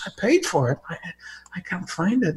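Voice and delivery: hushed Einstein. Retold or imitated. Einstein whispering in anecdote.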